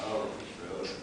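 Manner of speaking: soft unvoiced dreamy swoon; breathy swoon. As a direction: sigh the name like a crush. Breathy swooning repeat of name.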